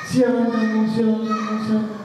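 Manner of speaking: the angry question repeated three times, trailing off; echo. Acted. The hill echoes the angry shout back.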